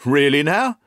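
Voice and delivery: exclaimed surprise. A narrator's reading. Inspector reacts to Holmes's claim, exclaiming in surprise. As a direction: cry out in interest, pitch lifted. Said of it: "cried the inspector".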